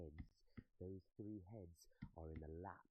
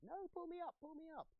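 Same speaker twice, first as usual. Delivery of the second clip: very high, urgent call for help; frightened squeal to be rescued; panicked cry; 4 dB louder than usual. Acted. Frightened brother calling to be winched up.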